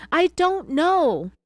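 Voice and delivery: high, loud, drawn-out imitation of crying; mock crying wail. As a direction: stretch it into a put-on wail. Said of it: "and she cried".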